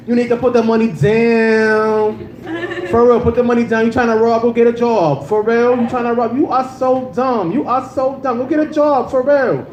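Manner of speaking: high sing-song scolding, campy; sweet, feminine guard. Acted. Sweet security guard scolds a bank robber, high and sing-song. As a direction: pitch it up high and lilt through the telling-off. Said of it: "can't be, like, sweet or whatever".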